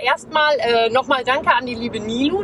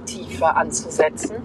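deep voice; 5 semitones lower than usual. Shown as a demonstration. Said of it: "ich versuche jetzt auch meine Stimmbänder etwas tiefer anzusetzen".

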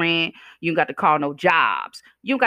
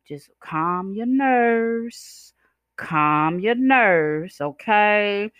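slow soothing; 3 semitones higher, much slower than usual. Acted. Telling listeners to calm down.

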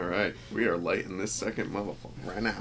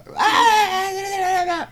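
mock crying; 7 dB louder than usual. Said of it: "And I'll be like".

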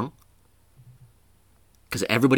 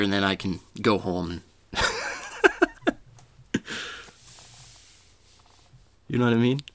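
ordinary delivery

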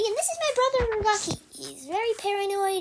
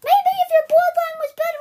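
loud, raised-pitch scolding; angry retort; 6 semitones higher, 9 dB louder than usual. Acted. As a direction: throw the insult back loudly. Acted insult in a quarrel.

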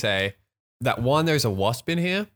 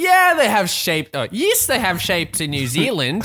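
higher, mock-patient friend voice; Kiwi friend; 7 semitones higher than usual. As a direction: play the friend, amused and obvious. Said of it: "She'll be like".

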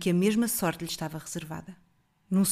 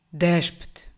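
loud looming monster command; voz ameaçadora; 2 semitones lower, 6 dB louder than usual. A narrator's reading. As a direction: order it like a beast. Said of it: "uma voz ameaçadora". (ordem de monstro ameaçadora)

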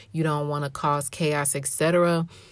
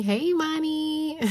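excited greeting; 11 semitones higher than usual. Real, not acted. Greeting the listener by name.

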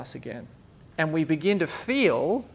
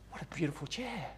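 much softer, hushed admiration; soft admiring; 16 dB quieter than usual. Retold or imitated. Restorer admiring a broken chair, hushed and soft. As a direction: breathe it out in quiet wonder. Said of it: "And the other person's like".

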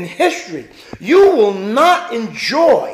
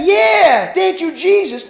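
mock shout; 7 semitones higher, 4 dB louder than usual. Mock casual reply shouted.

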